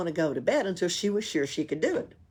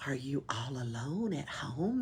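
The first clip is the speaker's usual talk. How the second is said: sly, soft question; sneaky low ask; a crafty hushed question; 4 semitones lower, 8 dB quieter than usual. Acted. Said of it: "they asked craftily".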